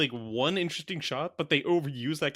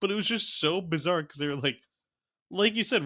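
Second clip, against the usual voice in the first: usual speaking voice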